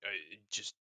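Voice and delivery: slow, halting apology in an orc voice; bashful orc tripping over words; nervous stuttering orc. Acted. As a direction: stammer it out, long hesitations. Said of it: "he's j-, he's stuttering".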